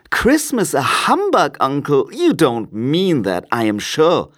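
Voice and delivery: incredulous nephew starting high; cheerful nephew. A narrator's reading. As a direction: protest in a high, good-natured voice. Nephew protests to uncle Scrooge.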